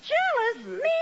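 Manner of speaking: high, sharp indignant echo; indignant disbelief. Acted. Wife denying she is jealous, high and indignant. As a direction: toss the word back as if absurd.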